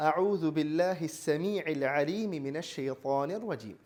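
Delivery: slow chanted Arabic recitation; slow measured Arabic chant; Quranic recitation. Shown as a demonstration. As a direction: recite it slowly and melodically, as in prayer. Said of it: "രണ്ടാമത്തേത്".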